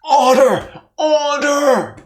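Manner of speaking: loud, high judge's bellow; mock judge yelling for order; judge shouting order. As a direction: bang the gavel with your voice. Judge calling court to order.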